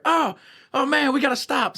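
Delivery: high, drawn-out alarmed pleading; mock panic. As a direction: imitate a panicked man begging to quit. Cellmate panicking, retold.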